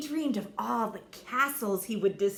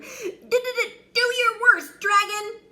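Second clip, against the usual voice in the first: very high stammering knight; terrified bravado; scared stutter; 13 semitones higher, 10 dB louder, slower than usual. Acted. Frightened knight stutters challenge.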